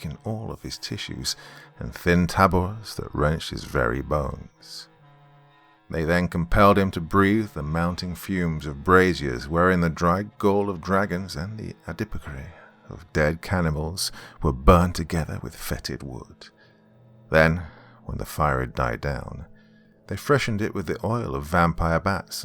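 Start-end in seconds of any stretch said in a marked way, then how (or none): none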